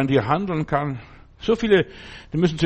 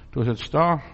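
usual speaking voice

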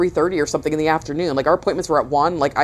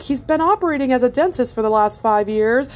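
higher, lightly hammed-up Dateline reporter; mock news anchor; 8 semitones higher than usual. Imagining a Dateline episode about the dentist, mock anchor. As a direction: play a lightly mock TV exposé voice. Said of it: "they're like".

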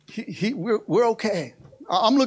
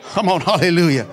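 ordinary talk, nothing marked

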